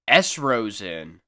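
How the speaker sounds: ordinary delivery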